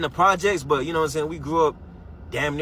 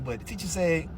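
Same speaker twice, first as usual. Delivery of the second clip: ordinary talk, nothing marked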